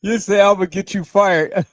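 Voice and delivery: loud, high-spirited talk through laughter; laughing. Real, not acted. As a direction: crack up as you read it back. Reacting to a viewer's funny comment.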